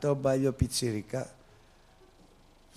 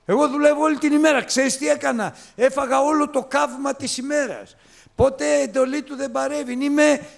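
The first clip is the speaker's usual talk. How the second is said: high, loud, fast complaint; resentful elder son; 9 dB louder than usual. Acted. Preacher voicing the elder son to the congregation, high and aggrieved. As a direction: whine like the jealous brother.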